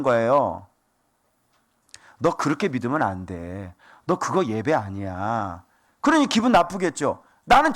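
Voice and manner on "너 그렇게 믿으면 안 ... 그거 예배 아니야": low, soft acted reproof; quiet admonishing. Preacher acting someone pointing out a believer's error, quietly admonishing. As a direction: drop low and spell out the correction.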